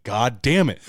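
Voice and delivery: comic beaten-again outburst; mock frustration. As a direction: curse your rotten luck out loud. Retold frustration at being beaten.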